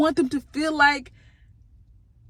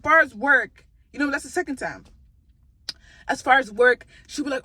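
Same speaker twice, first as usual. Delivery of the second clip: ordinary talk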